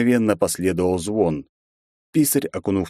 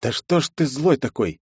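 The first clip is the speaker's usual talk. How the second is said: snide; 6 semitones higher than usual. A narrator's reading. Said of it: "ехидно спросил я".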